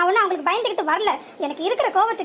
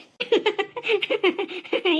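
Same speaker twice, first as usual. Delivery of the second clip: mocking laugh. Mocking laugh during quarrel.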